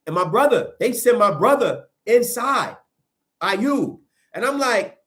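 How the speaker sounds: normal talking voice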